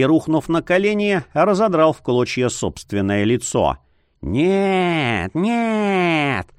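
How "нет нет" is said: shifting voice. A narrator's reading. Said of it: "Голос его менялся".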